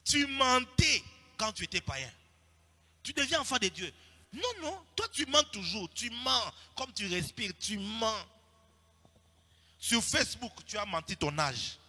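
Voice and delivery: loud, high preaching voice in French and Lingala; fiery sermon delivery; full-force preaching. Acted. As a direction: drive the rebuke home to the congregation.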